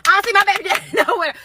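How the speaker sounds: said again while laughing at herself. Real, not acted. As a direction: crack up while repeating it.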